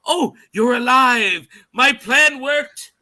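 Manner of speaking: high, loud, drawn-out fake delight; bluffing villain. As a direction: gush slowly, as if faking happy surprise. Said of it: "like, a bullshit thing".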